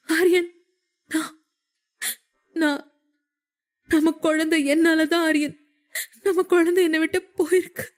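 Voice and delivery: high, broken sobbing voice; wailing cry. A narrator's reading. Grieving mother breaks down to her husband over their lost baby, sobbing. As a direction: sob it out, choking on each word. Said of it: "என்று கதறி அழுதாள்".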